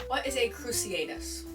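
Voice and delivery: normal talking voice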